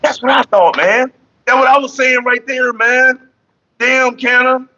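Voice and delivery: excited teasing. Real, not acted. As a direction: rub it in playfully. Teasing banter, high and loud.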